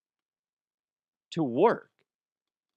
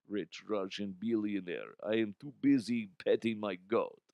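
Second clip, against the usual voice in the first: put-on Russian accent; 6 semitones lower, 7 dB quieter than usual. Rich Russian character explaining he's too busy, in a low Russian accent. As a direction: purr like a lazy rich Russian.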